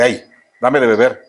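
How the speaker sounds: a curt, careless "Hey, dame de beber"; rude demand. Retold or imitated. The rude way Jesus did not ask for water, curt. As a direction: toss it off like an order. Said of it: "No le dice".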